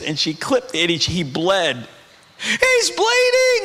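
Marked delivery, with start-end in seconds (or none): 2.4-3.6 very high, drawn-out panicked cry; hammed-up freak-out, stretched and piercingly high; mock parental panic. Preacher acts out new parent seeing baby's blood, mock panic.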